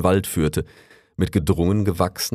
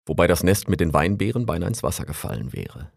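usual speaking voice

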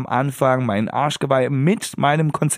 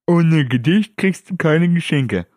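mother voice; 5 semitones higher, slower than usual. Retold or imitated. Mother voiced in Christmas story.

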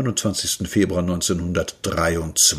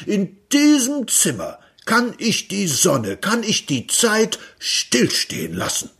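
much higher, rushing then slowing grandly; rapturous inventor; 11 semitones higher than usual. Acted. Inventor explaining stopped time to Peter, rapturous. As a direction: build to a prophetic cry.

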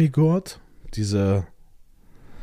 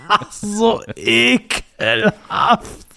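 disgusted; 9 semitones higher, 3 dB louder, much slower than usual. Retold or imitated. Recalling leaking Erfrischungsstäbchen.

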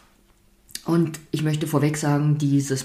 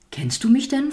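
higher-pitched, mildly wary question; narrated suspicion, pitched up; mistrustful; 9 semitones higher than usual. A narrator's reading. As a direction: ask it with a touch of mistrust. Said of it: "fragte die Traurigkeit misstrauisch".

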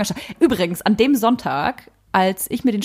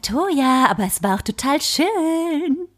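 lightly voiced sweet body, higher and slow; body's voice; 7 semitones higher, slower than usual. The body soothing her after birth, high and sweet.

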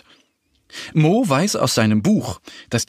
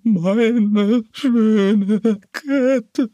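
crying deer; 8 semitones higher, much slower than usual. A narrator's reading. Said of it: "Das Reh weint".

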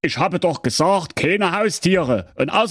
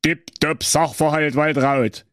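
mild cheer; 5 semitones higher than usual. Acted. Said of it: "jauchzte der Werner".

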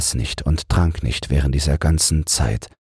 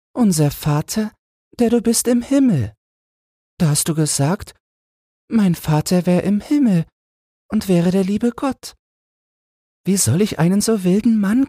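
put-on high child's voice, impatient; a little boy protesting to his mother; the boy protests to his mother, high and impatient. A narrator's reading. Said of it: "Da ward das Knäbchen ungeduldig".

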